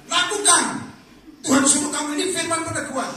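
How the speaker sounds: loud, high, firm go-ahead; bold pastor's command. Retold or imitated. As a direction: give a ringing blessing to act. Pastor answering a believer boldly. (perintah tegas dan lantang seorang pendeta)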